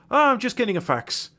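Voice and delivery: high-pitched, mock Irish accent. Irishman in the bushes answering his golf mates, high mock Irish accent. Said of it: "their Irish mate".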